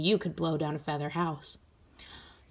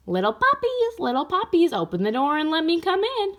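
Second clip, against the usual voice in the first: very high, loud sing-song bug voice; a flea calling at the shoe house door; squeaky flea shout; 9 semitones higher, 3 dB louder than usual. Acted.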